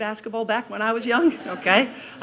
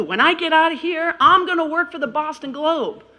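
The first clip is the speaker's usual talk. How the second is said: eager boast; 10 semitones higher, 5 dB louder than usual. Retold or imitated. Young self boasting.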